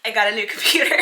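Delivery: loud, laughing announcement; real joy. Real, not acted. Vlogger revealing a long-needed purchase to viewers, overjoyed. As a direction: burst out with it, overjoyed. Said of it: "can you hear the joy in my voice?".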